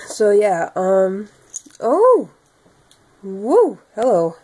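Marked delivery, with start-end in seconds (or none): 1.8-4.4 startled exclamation. Real, not acted.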